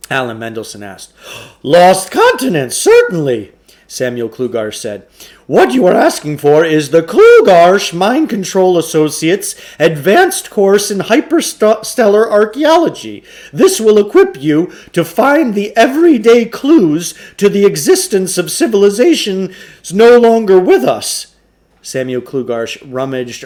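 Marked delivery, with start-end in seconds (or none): 1.6-3.5 higher, louder salesman's patter; Samuel Klugarsh voice. Acted. Shopkeeper pushing a trade-in on the boys, higher, louder salesman voice. 5.5-21.3 higher, louder salesman's patter; Samuel Klugarsh voice. Acted. Shopkeeper pushing a trade-in on the boys, higher, louder salesman voice.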